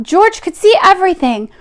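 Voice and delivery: usual speaking voice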